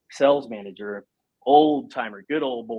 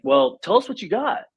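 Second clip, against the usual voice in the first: put-on higher, cheerful girl voice; young receptionists; 13 semitones higher, 4 dB louder than usual. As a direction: play the girl at the desk, voice lifted. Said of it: "they're like".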